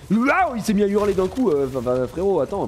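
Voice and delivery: high, loud startled outburst. Real, not acted. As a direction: jump at the sudden scream and blurt it out. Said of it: "Putain, il m'a fait peur ce con".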